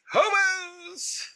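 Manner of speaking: high, drawn-out cry of the word; mock hobo cry. Echoing a co-host's definition, mock high cry. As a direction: cry it out long and high, hammed-up.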